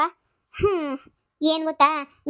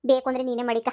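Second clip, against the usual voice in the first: normal talking voice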